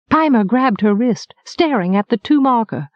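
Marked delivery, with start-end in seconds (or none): none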